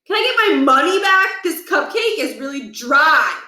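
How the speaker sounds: high, whiny demand for a refund; complaining kid Stuart. Acted. Stuart complaining to Holly at the bake sale, high and whiny. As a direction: pester the seller like a fussy customer.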